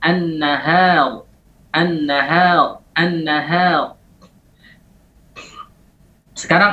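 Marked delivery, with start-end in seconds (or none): 0.0-4.0 an-nahar said slowly with the ha kept light; careful recitation; slow demo. Shown as a demonstration. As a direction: draw out an-nahar, ha not thickened. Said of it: "tafkhimuha fi nahwi Allah".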